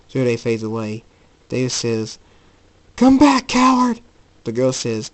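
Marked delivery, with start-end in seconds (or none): 2.9-4.0 loud, high-pitched challenge; angry shout. Acted. David as the enemy fades away, yelling angrily. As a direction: yell after someone getting away.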